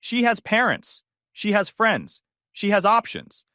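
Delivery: high, loud, slow call across the room; calling over. Retold or imitated. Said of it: "called over".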